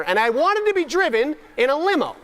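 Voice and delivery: cracking up at the limo wish; laughing. Real, not acted. Speaker laughing at his childhood wish, high and slow.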